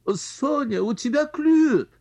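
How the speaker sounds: high squeal of excitement; shrill aunt's squeal. Acted. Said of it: "взвизгнула тётя".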